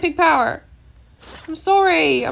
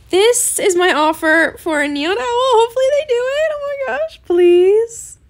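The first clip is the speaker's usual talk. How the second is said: loud, climbing higher into a plea; excited pleading; 6 semitones higher, 6 dB louder than usual. Real, not acted. Gamer hopes her Neon Owl offer is accepted, excited pleading. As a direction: build to a high, hopeful beg.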